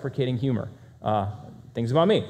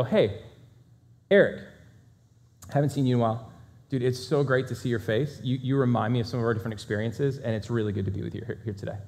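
softer, sincere and unhurried welcome; warm friendly greeting; 4 semitones lower, 4 dB quieter than usual. Shown as a demonstration. Presenter shows a genuinely warm greeting to Eric, sincere and soft. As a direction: greet an old friend as if truly glad to see him. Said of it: "but the body language".

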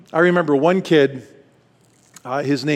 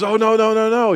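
mother's hurried denial; 8 semitones higher, 4 dB louder than usual. Retold or imitated. Mother's urgent denial, voiced.

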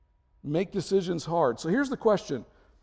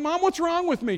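much higher, offended question; mock indignant; 12 semitones higher than usual. Son protesting to his mother, mock offended. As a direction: play the wounded son.

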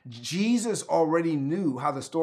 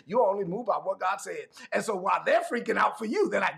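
usual speaking voice

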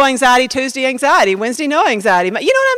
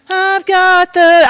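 slow, higher, held-out words; mock drawn-out declaration; 5 semitones higher, much slower than usual. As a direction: stretch each word on a raised note. Acts out victory claim faltering.

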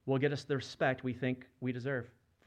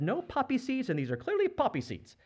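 indignant complaint; 11 semitones higher than usual. Acted. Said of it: "I said".

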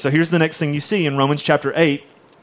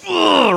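mock exasperated groan, pitched way up; frustrated groan; 10 semitones higher than usual. Praying believer too frustrated for words, acted exasperated groan. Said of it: "I'm just screaming out".

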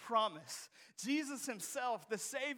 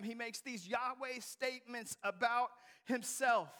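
usual speaking voice

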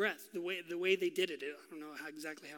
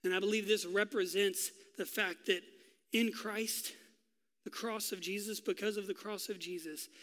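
usual speaking voice

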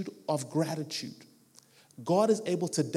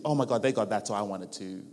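low, mock-envious mumble; envious grumbler; 8 semitones lower than usual. Preacher voices someone envying what others got, low mock envy. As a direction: imitate someone grudgingly coveting, dropping low. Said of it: "not an attitude of envy".

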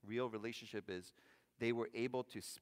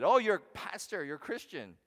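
mock-eager stranger, higher and louder; 6 semitones higher, 9 dB louder than usual. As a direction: play the gushing guy who spotted a pastor. Pastor mimics an eager coffee-shop stranger.

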